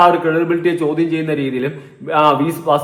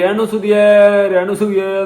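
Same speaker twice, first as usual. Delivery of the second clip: higher, drawn-out imitation of someone calling; mock street-caller chant, raised pitch; 4 semitones higher, 3 dB louder, slower than usual. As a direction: mimic people endlessly hollering a name. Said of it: "എവർ ഇതുപോലെയാണ്".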